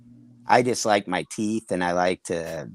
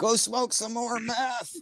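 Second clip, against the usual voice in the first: high and slow, a softer mock jeer; mocking taunt; 12 semitones higher, 6 dB quieter, slower than usual. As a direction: drag it out like a lazy heckler. Strangers taunting him while he shops.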